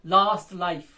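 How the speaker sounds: last life as two separate strong beats; last life, both words firmly stressed; equal stress. Shown as a demonstration. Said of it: "same intensity दोनों सेल balance की है".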